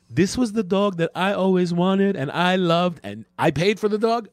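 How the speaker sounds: mocking high note voice. Reading Josh's note.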